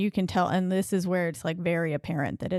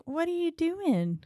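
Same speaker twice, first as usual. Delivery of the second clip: creepy sing-song; 10 semitones higher, slower than usual. Acted. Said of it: "she's like".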